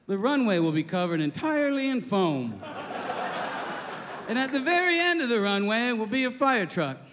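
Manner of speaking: captain speaking to passengers on the intercom; pilot announcement. Acted. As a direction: reassure the cabin like a captain. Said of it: "the pilot got on again. He goes".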